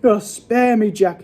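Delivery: high pleading yell. A narrator's reading. Dreaming giant begs Jack, yelling. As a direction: yell high, begging for mercy. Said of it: "he yelled".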